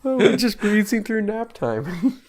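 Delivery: high, slow, laughing quote; sing-song Buster yawning line; Buster impression. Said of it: "Where Buster's yawning in the conference room".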